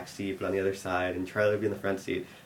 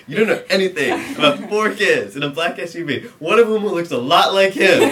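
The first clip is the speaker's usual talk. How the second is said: loud, high insistent cop voice, laughter creeping in; mock interrogation; 8 semitones higher, 11 dB louder than usual. Cop grilling the same boy over and over, loudly insistent. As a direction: hammer the question, imitating a frustrated cop. Cop repeats question to Charlie.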